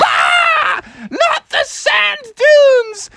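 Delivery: very high, drawn-out wail of dread; horrified scream. Acted. Froggy hearing of the sand dunes, screaming in horror.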